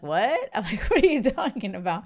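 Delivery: incredulous retort with a laugh; replayed "are you serious?" reaction, half laughing; mock disbelief. Retells her disbelief at boyfriend's reply.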